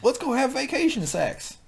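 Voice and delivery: high-pitched put-on voice; high mock woman. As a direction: coo it in a high voice.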